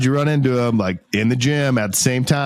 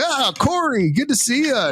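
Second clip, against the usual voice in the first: much higher, cheery hello; mock excited greeting; 13 semitones higher than usual. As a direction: overplay the happy reunion. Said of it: "I'm like".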